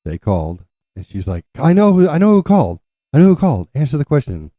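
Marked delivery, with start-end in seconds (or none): 1.6-4.5 high, loud yell imitating a fed-up mom; exasperated. Mom driven nuts by deliberate dad, exasperated shouting. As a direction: cut him off, voice shooting up in exasperation. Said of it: "it would just drive her nuts".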